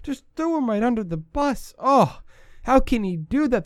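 high, hammed-up indignant voice; mocking outraged fans. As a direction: parody the whiny social media crowd. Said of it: "What I heard a lot on social media".

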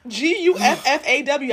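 G U F F A W spelled slowly letter by letter; spelling it out. Shown as a demonstration. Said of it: "third grade spelling bee champ".

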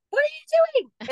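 high, loud shocked yell; retold startled yell. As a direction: shriek it at a toddler who broke glass. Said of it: "And I'm just like".

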